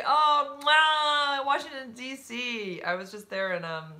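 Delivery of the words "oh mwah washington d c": loud, high, gushing greeting with a kiss; delighted outburst. Real, not acted. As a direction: shout your love for the city, drawing out the name. Delighted at viewer's Washington greeting.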